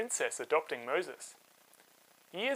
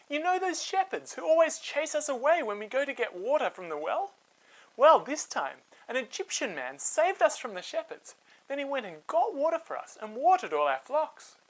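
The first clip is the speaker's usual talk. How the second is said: higher, eager retelling; excited telling; 9 semitones higher than usual. Acted. Sisters telling their father about the Egyptian, excited. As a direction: bubble over with the news.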